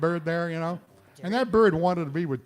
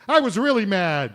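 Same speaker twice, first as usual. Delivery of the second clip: mock anger; 3 semitones higher, 9 dB louder than usual. Voicing an annoyed ticket victim.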